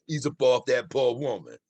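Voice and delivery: normal talking voice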